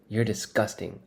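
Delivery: usual speaking voice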